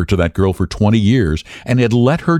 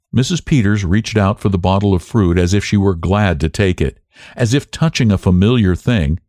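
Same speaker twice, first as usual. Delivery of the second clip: plain reading voice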